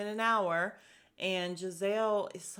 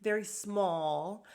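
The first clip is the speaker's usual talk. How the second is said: slow, drawn-out very small; mock drawn-out; much slower than usual. Robin describing her wedding plans, slow and coy. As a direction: stretch it out coyly. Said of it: "she said very about twelve hundred times".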